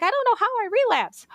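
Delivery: high-pitched, whiny helpless imitation; mock cluelessness. Mocks what everyone says.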